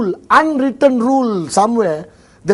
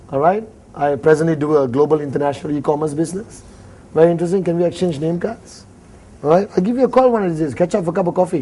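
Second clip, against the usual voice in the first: rushed patter; 5 semitones lower, 4 dB quieter, faster than usual. Pretend. Rushed networking small talk.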